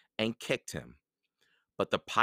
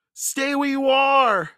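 high, slow, very loud warning; shouting; 10 dB louder, slower than usual. A narrator's reading. Jack, caught, warns Annie off, shouting. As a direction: shout it long across the distance. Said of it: "Jack shouted".

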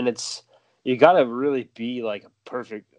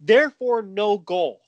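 high, loud pompous breakdown; hammed-up TV hockey analyst; mock analyst; 8 semitones higher, 4 dB louder than usual. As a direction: lecture the play like a smug analyst.

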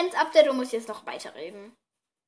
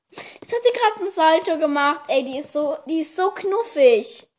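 high, louder gush, stretched at the end; gushing delight; 4 semitones higher, 6 dB louder than usual. Real, not acted. Podcaster watching her cat do a somersault, gushing. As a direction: marvel at the pet, drawing out the adoration.

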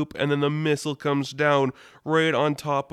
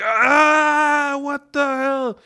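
much higher, louder cry of pain; pained shriek; 14 semitones higher, 8 dB louder, much slower than usual. Retold or imitated. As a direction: yelp as the sword goes in. Sam stabbed through wrist.

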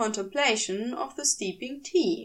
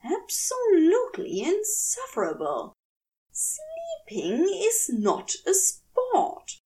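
exasperated Aziraphale; 8 semitones higher than usual. A narrator's reading.

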